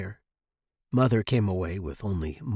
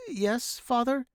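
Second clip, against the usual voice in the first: put-on high, meek kid voice; small, anxious yes to a father; timid boy; 11 semitones higher, slower than usual. A narrator's reading. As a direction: answer like a cowed son.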